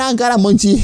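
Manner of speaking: high, singsong goofy voice; goofy silly voice. Pretend. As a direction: play it like a giddy cartoon kid.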